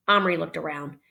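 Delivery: plain reading voice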